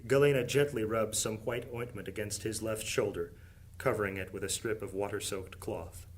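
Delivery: ordinary delivery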